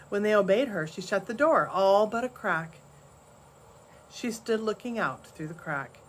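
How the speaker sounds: plain reading voice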